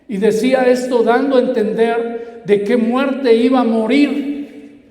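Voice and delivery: loud, high proclamation; full-force preaching. Real, not acted. Preacher declaring the judgment of this world, loud high preaching.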